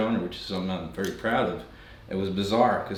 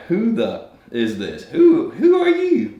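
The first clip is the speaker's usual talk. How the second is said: much higher, laughing mock disbelief; baffled Americans; 12 semitones higher, 6 dB louder than usual. Americans at the contest gawk at the Swedish cowboy, mock shocked squeal. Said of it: "And they're like".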